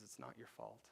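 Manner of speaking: quiet, gentle retelling of the therapist; soft reassurance. Retold or imitated. Therapist Sean tells Will softly, quiet reassurance. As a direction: lay it down softly, like comfort.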